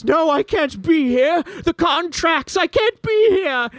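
high, slow, strained whine of panic; panicked villain. Acted. GM voices Berzin waking in hell, high panicked whine. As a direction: whimper in terror at waking in hell. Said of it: "And he says".

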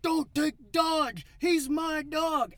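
loud, high, drawn-out wail; hammed-up bawling kid, stretched-out words; mock bawling. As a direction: imitate a kid crying out in protest.

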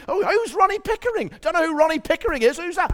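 mock taunting biker; motorcyclist taunting. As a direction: needle him like a cocky biker. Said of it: "And the guy says".

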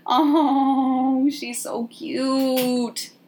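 high, drawn-out coo; cooing adoration. Real, not acted. Podcaster gushing over the tiny pet asleep on a lap, cooing. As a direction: melt over it with long high vowels.

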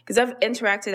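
ordinary talk, nothing marked

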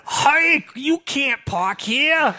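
high, very drawn-out hammy impression; Arnold Stang. Co-host imitating the parking heckler, high exaggerated comic voice. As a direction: stretch it out loud and high in mock imitation. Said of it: "He sounded like it was Arnold Stang".

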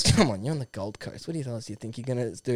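low, soft, confidential coaxing; Gary Lyon, coaxing. Acted. Older pundit talking the young player round, low and coaxing. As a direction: drop low and soft to persuade. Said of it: "And then Gary Lyon goes".

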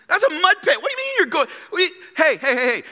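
mock-outraged commander, voice pitched high; outraged Naaman. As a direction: scoff at the muddy river like an offended general. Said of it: "Naaman sees the Jordan".

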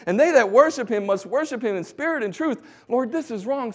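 indignant shout to the Lord; outraged prayer. Retold or imitated. Preacher recalling praying at Thai statue, loud outraged cry. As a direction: cry out to God, high and loud.

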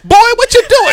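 high, loud scolding mother; fussing mama. Retold or imitated. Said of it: "she turned into my mama".

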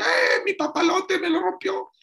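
put-on high wailing shout of a small child; crying little boy. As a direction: bawl like a kid whose kite broke. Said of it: "un niño chiquito". (niño chiquito llorando a gritos)

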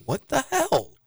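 high-pitched mock disbelief; imagined baffled reaction. Player watching the press conference, mock bafflement. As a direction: squeak it out like a baffled onlooker. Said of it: "Okorafor is probably watching the press conference like".

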